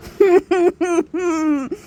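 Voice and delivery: long, high squealing laugh; high-pitched laugh. Real, not acted. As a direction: burst into a high, drawn-out laugh. Bursts out after her remark.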